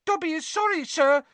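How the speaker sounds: very high squeaky elf voice; anxious squeak. A narrator's reading. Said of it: "squeaked Dobby anxiously".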